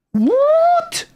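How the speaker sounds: high squeaked disbelieving what; shocked what. Real, not acted. Gamer reading patch notes to viewers, shocked squeak. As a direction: shriek it in pure disbelief. Shocked at news not carrying over.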